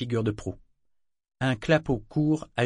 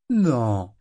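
curt no; 4 dB louder than usual. Acted. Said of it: "répondit-il laconiquement".

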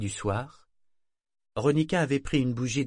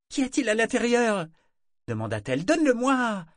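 put-on much higher, louder pleading girl; demanding girl; 12 semitones higher, 4 dB louder than usual. A narrator's reading. Girl grabbing at the box her grandmother holds. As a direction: whine to get the box.

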